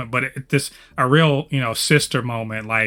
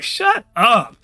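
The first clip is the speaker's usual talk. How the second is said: a higher mock "shut up"; mock shout; 9 semitones higher than usual. Fan retelling shutting up Charles, mock shout. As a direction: snap it at him, half joking. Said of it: "I was like".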